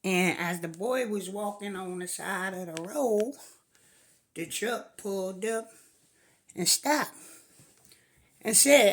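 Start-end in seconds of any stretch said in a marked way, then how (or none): none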